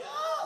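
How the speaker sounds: high excited cry; mock squeal of joy. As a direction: squeal with delight. Dead Sea excitement.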